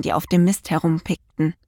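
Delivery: ordinary delivery, nothing marked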